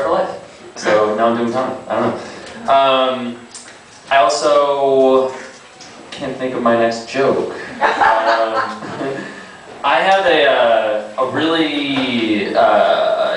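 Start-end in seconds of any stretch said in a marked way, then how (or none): none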